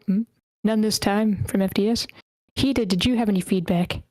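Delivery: ordinary talk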